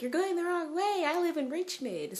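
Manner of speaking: high, loud mock scolding; exasperated friend. Bria on the phone scolding her lost friend, exasperated. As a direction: squeal at her for getting lost. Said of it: "she's like".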